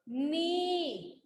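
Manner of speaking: "नी" with the ee held long; long ee vowel. Shown as a demonstration. Teacher modelling the matra for students, long-drawn "नी". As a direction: stretch out the vowel of "नी". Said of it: "लंबा होता है ना".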